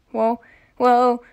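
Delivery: ordinary talk, nothing marked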